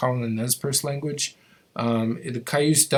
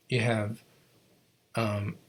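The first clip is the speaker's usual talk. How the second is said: usual speaking voice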